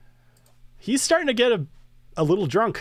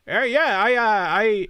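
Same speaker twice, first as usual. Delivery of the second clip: put-on old man voice; 5 semitones higher, 3 dB louder, slower than usual. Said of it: "that's my old man voice".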